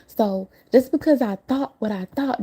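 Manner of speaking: ordinary talk, nothing marked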